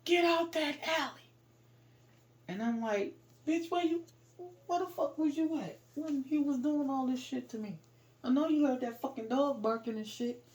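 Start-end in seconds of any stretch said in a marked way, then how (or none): 0.1-1.3 high, loud imitated yell; retold warning shout; man's yell. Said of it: "I heard a man yell". 3.4-10.4 high-pitched, exasperated retelling; retold angry challenge; indignant retort. Retold indignant answer to the man.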